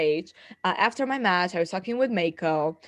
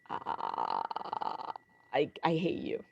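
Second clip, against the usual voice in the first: much lower, softer grumble; jealous friend; 7 semitones lower, 9 dB quieter than usual. Retold or imitated. AJ envious over the Kobashi meeting, low grumble. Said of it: "And he was like".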